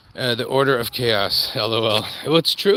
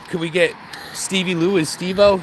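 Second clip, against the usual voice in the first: usual speaking voice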